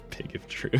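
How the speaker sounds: whisper. Real, not acted. Whispered aside to chat.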